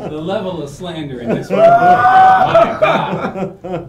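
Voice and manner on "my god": high squeal through laughter; laughing exclamation. Real, not acted. Friend reacting to the room's slander, squealing with laughter. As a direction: shriek it while cracking up.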